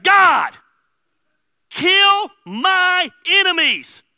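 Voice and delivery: slow, high, full-voiced shout; shouted prayer. David praying against his enemies, slow furious shout. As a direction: bellow the plea, dragging each word out. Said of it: "He's like".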